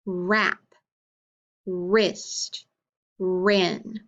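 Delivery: long held R opening "Wrap", "wrist" and "wren"; "Wrap", "wrist", "wren" each begun on a drawn-out R; stressed initial sound. Shown as a demonstration. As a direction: stretch the first R of "Wrap", "wrist", "wren", with silent W. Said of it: "What sound do you hear at the beginning?".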